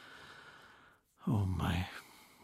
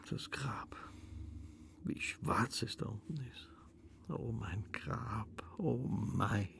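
hushed breathy awe at the dark grave; awed whisper; 9 dB quieter, slower than usual. Acted. Dead woman whispering to herself in the grave, awestruck. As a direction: whisper it as if peering into blackness.

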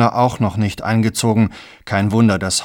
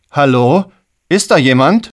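raised, questioning call through a door; 4 semitones higher, 7 dB louder than usual. Acted. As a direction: call louder to see who's there. Ruft durch die geschlossene Tür. (laut fragend rufen)

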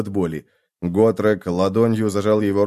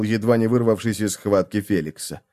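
usual speaking voice

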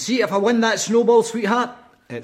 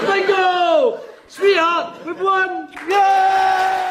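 excited shout; 10 semitones higher, much slower than usual. Acted. Wins at bingo.

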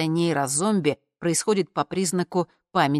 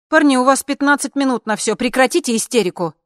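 loud, high, urgent orders; irritated organiser cracking down; exasperated scolding; 9 semitones higher, 8 dB louder than usual. A narrator's reading. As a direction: tell them off and hurry them. Organiser scolding the two men.

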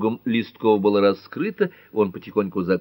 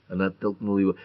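plain reading voice

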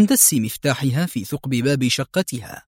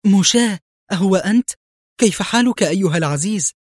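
high, louder delighted greeting; happy exclamation; 7 semitones higher, 3 dB louder than usual. A narrator's reading. Woman hanging on her old friend's neck, delighted. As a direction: cry out in joyful recognition. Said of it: "وهتفت في سعادة".

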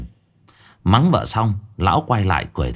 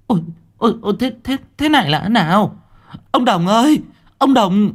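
panicked; 12 semitones higher than usual. Acted. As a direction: call out to Đồng in alarm. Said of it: "thì hốt hoảng".

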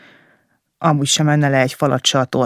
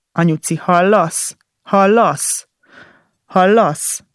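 kétségbeesett szólongatás; 4 semitones higher, 3 dB louder, slower than usual. Acted. Az anyját szólongatja a kórházban.

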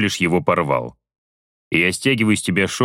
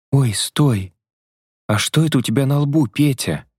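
higher, quick surprised female voice; surprised woman; 6 semitones higher than usual. Acted. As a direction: stop him mid-move, startled. Vasya surprised.

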